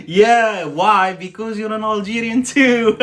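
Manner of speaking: loud, high teasing; playful tease. Real, not acted. Teasing, laughing at own joke.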